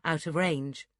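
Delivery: ordinary delivery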